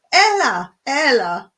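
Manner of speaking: Ella called out high and loud, falling; mother calling. Retold or imitated. A village mother calling her child in, loud call. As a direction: call your child in from the street. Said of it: "a mother come out and go".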